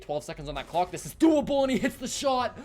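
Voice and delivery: loud, high, excited clutch call; esports caster. Real, not acted. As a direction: build up and scream the final kill. Caster calling a clutch ace.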